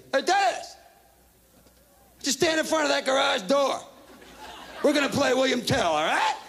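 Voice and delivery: put-on high, bright call to the kid; mock big brother luring him over; older brother calling. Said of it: "my brother says to me".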